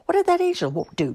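high-pitched indignant challenge; demanding. A narrator's reading. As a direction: demand an explanation, voice jumping up. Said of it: "Imogene demanded".

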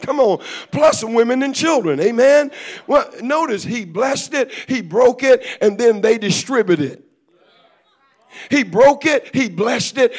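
loud, high, impassioned preacher delivery; full-force preaching. Real, not acted. Preacher urging the congregation on about broken bread, impassioned and loud. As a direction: drive each phrase home at full preaching power.

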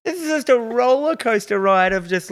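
high, drawn-out disbelief; slow, high-pitched amazed retelling; mock bewilderment. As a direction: exclaim it in giddy, exaggerated confusion. Retelling own bewildered cinema reaction.

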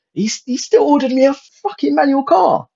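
the customer fuming at the salesman; incredulous outrage. Real, not acted. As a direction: pitch shoots up in outrage.

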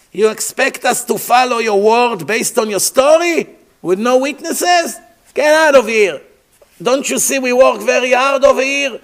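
loud high mocking jeers; a crowd dismissing a fool, loud and high; scornful crowd. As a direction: shout him down like annoyed slaves. Slaves mocking Moshe.

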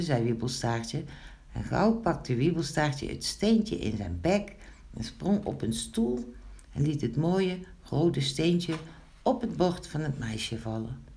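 ordinary delivery, nothing marked

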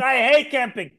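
high, loud, exasperated; blunt complaint. Retold or imitated. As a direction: whine it out loud and fed up. Wife drops the act.

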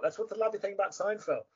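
ordinary talk

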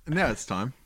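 announcer voice. Acted. Announcing segment.

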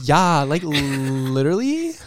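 put-on slow rising Valley girl "literally"; Valley girl accent. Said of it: "Sounds like a Valley girl".

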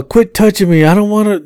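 high, irritated snap; irritated girlfriend. Retold or imitated. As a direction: push him off with the voice. Girlfriend scolds.

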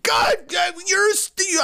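high startled shout of disbelief; shocked outburst. Real, not acted. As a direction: blurt it out, caught off guard. Shocked friend never saw movie.

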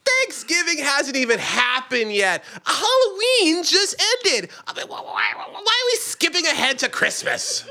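high, incredulous, exasperated complaint; exasperated narrator-character. Acted. Narrator complains to Yulin about skipping ahead, exasperated. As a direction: protest the early Christmas, half laughing.

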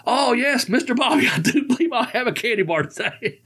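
high-pitched mock eager acceptance; Sheldon the limo driver. Limo driver finally accepting a candy bar, hammed-up high imitation. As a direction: imitate the delighted driver, voice jumping way up, breaking into laughter. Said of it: "he goes".

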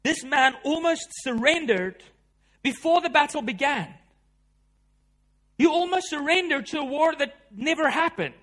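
thundering sermon climax; full-force preaching. Acted. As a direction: drive the point home from the pulpit.

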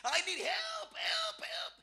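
high, breathy mock cry for help; mock scream for help. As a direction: imitate someone crying out in pain. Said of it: "they're screaming".

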